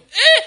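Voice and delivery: very loud, high cry of alarm; shocked yelp. Acted. David hearing "thus says the Lord", shocked yelp.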